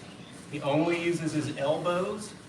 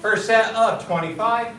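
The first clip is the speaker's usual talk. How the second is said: loud, higher drill command; calling out; 6 semitones higher, 10 dB louder than usual. Real, not acted. Instructor to kids before piston kicks, calling out. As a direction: announce it forcefully to the room.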